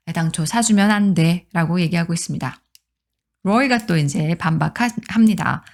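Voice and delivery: ordinary talk, nothing marked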